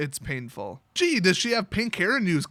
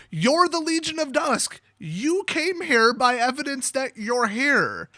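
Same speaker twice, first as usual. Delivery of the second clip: exasperated; 6 semitones higher than usual. Real, not acted. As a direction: spell out the obvious in exasperation. Exasperated rant at the character.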